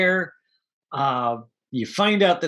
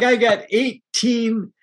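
mock stern captain; 7 semitones higher, slower than usual. Captain scolds about the crew.

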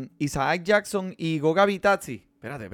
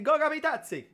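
Italian accent; 11 semitones higher, 4 dB quieter than usual. Shown as a demonstration. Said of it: "tiene que decirlo con el acento italiano".

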